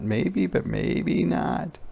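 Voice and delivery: ordinary delivery, nothing marked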